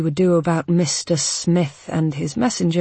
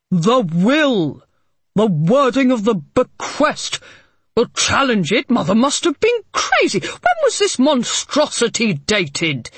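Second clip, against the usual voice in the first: angry; 5 semitones higher, 4 dB louder than usual. A narrator's reading. Harding furious, demanding.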